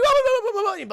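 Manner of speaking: high, loud cartoon gibberish; panicked babbling. Dog facing Bigfoot, panicked babble. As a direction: babble in mock terror.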